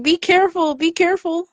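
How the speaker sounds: put-on calling out a high, anxious warning to the toddler; retold shouting. Aunt calling out to her niece on the steps, a high warning shout. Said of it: "I kept shouting".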